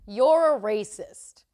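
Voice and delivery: retold loud, drawn-out accusation; slow, projected call-out. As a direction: declare it loudly and slowly for everyone to hear. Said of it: "loudly, I'm like".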